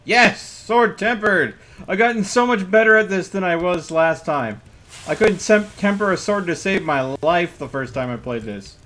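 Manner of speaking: loud, high triumphant cheer; excited. Real, not acted. Gamer cheering his perfectly tempered sword, loud and excited. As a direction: whoop it out, thrilled with the win.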